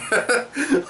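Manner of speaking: sudden high squealing laugh; burst of laughter. Real, not acted. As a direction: crack up at your own punchline. Laughing at his own joke.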